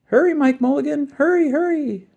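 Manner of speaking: put-on high, urgent child's cry; boy cheering the digger on, high and eager; little boy shouting. A narrator's reading. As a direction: urge them on like an anxious kid. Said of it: "shouted the little boy".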